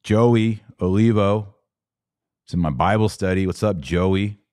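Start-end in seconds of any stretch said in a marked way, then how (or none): none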